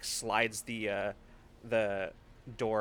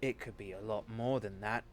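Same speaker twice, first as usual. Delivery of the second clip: menacing Galt; 5 dB quieter than usual. Acted. Galt threatening the driver, quiet and menacing. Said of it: "Galt says".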